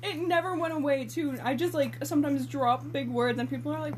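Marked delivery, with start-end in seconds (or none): none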